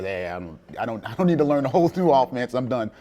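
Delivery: playfully overwhelmed, voice jumping up; mock protest. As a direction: throw up a loud, jokey objection. Mock refusal of son's football lesson.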